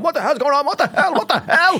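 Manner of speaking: very high, frantic repeated what the hell; dad panicking in circles. Retold or imitated. Dad running round the garden in circles, frantic high yelling. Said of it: "Me dad did the mad thing".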